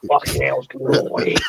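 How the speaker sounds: put-on slow Cockney tough-guy accent; Ray Winston. Demonstrating an impression for a mate, Ray Winston Cockney accent. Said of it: "like, a Ray Winston kind of accent".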